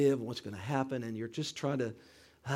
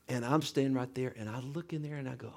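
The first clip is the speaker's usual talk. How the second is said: ordinary talk, nothing marked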